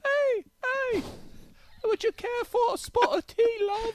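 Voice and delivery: tiny, very high British falsetto; absurdly high-pitched British impression; British Messi voice. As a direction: squeak out a British accent, with pauses. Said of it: "a British messy voice".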